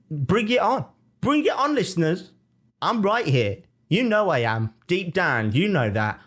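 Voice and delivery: high-pitched, fired-up defiance; rallying challenge. Real, not acted. Host daring listeners to send comments, fired up.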